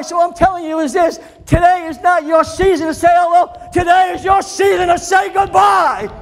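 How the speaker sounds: loud, high shouted preaching; full-force preaching. Real, not acted. Preacher rallies the congregation, shouting high and loud. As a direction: preach it at the top of your voice.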